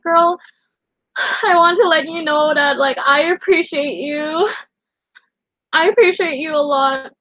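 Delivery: sincere, choked-up thanks; tearful comfort. Retold or imitated. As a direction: comfort her friend through tears.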